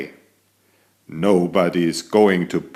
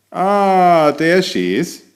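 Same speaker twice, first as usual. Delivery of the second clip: pleased spotting; 8 semitones higher, 4 dB louder, slower than usual. Acted. Cat spots his kangaroo friend.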